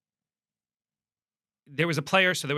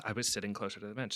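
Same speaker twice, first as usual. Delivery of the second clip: ordinary talk, nothing marked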